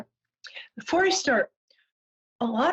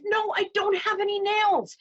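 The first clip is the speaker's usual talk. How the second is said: high-pitched mock exasperation; annoyed bartender. Bartender sending off a duck, high and exasperated. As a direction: protest high, dismissing a silly customer. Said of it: "And the bartender says".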